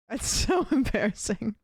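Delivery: laughing through the words; laughing embarrassment. Real, not acted. Streamer caught in a spelling slip by chat, laughing embarrassment. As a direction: laugh helplessly at your own blunder.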